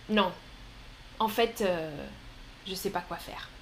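dropping low and quiet, unsure; quiet, deflated admission; lost and low. Shown as a demonstration. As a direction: sound lost, voice sinking. Acted troubled reply.

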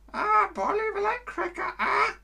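very high squawking parrot. Pretend. Parrot imitation in bird lesson.

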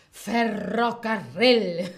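"Ferrocarril" slow and loud, the rr rolled overlong, laughing; exaggerated trill. Shown as a demonstration. Teacher hams up the trill for shy learners, laughing. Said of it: "puedes exagerar la pronunciación".

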